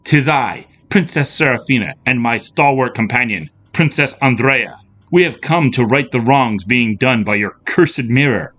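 high, declamatory princess voice; heroic princess, proud. A narrator's reading. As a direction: proclaim it grandly to the beast. Princess announces herself to beast.

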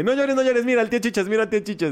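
put-on high, sing-song cooing to a crying baby; uncle's brother hushing the crying niece in a high sing-song; soothing baby. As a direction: coax the baby to stop crying, high and sweet.

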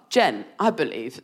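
ordinary delivery

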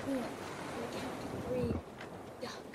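low-voiced secret instruction; whisper in ear. Rock secretly telling Lua to duck, whispered. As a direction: drop it softly, for her ear only. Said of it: "kind of whisper in her ear".

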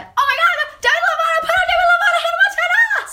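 shrieking very high and loud; mock fan scream. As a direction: parody screaming fangirl roommates. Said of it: "skrika så här". (hysteriskt fanskrik)

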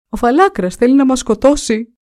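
frantic shout. A narrator's reading. Prince answers his father, shouting in panic. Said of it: "και φωνάζεις έτσι".

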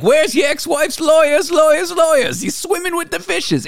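high mock Italian gangster voice; Mickey Meatball. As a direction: deliver it like a cartoon mobster. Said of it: "he made this character called Mickey Meatball".

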